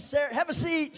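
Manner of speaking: excited hostess opening the door; cheerful greeting. Retold or imitated. Sister Branham greets at door.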